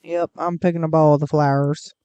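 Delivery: a hammed-up Southern accent, screw it attitude. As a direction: slip into a folksy Southern accent while grabbing flowers. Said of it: "I don't know why I'm doing a southern accent".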